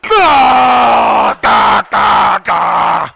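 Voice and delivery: loud choking snore gasps; sleep apnea impression. Shown as a demonstration. Impression of a sleeper with apnea, loud choking gasps. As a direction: choke and snort loudly like a sleeper. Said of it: "I'm gonna do an impression of sleep apnea".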